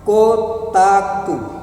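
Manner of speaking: drawn-out title, then sustained declaimed lines; stately, half-chanted poem reading; poetry recitation. Acted. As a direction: recite it slowly with long pauses between phrases. Begins reciting second poem.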